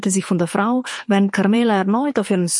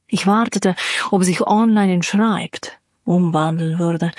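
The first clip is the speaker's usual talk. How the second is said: ordinary delivery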